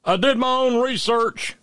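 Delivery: high mocking Southern yokel voice. Host mocks anti-vaxxer tombstone slogans in a high yokel voice. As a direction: sneer it high like a vaccine sceptic. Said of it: "tombstones read with things like".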